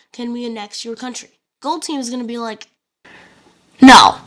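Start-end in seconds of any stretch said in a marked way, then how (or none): 0.1-1.4 calm, flat, matter-of-fact ask; deadpan. Acted. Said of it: "deadpan it". 3.8-4.2 sharp shouted refusal; loud no. Acted. Gold leader flat refusal.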